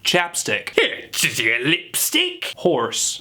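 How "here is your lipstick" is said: high, slow, hammed-up British; British accent. Shown as a demonstration. Quickfire round for chapstick, exaggerated British accent. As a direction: drag it out in a silly British voice. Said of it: "how to pronounce it".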